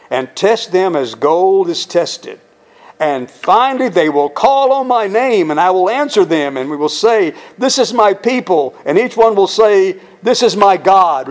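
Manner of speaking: fervent scripture reading. Acted. Pastor reading Zechariah's promise to the church, building fervently. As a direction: build the prophecy toward its climax. Preaching scripture at full force.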